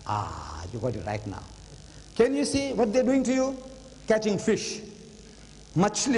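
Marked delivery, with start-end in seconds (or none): 0.1-1.4 satisfied quiet. Retold or imitated. Sheikh finally reads it right.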